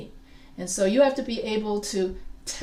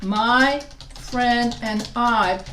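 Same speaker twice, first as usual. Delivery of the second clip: slow and louder, each word set apart; slow, emphatic correct form; 4 dB louder, much slower than usual. Shown as a demonstration. Teacher correcting the class, slow and emphatic. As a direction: stress the right pronoun slowly. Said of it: "Why is that correct?".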